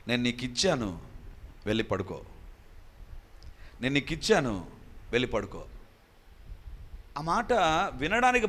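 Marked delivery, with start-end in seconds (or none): 0.1-5.6 lower, calm voice of God. Retold or imitated. God tells the praying pastor to sleep, low and calm. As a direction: speak as God answering at night, low and settled. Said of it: "ఆ స్వరం ఏంటంటే".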